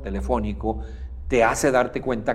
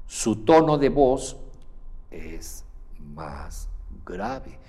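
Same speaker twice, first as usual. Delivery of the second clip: lower pitched voice; much slower than usual. Shown as a demonstration. Coach demonstrating a lower feeling tone. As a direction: sink the voice down low. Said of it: "Lo estoy exagerando un poco".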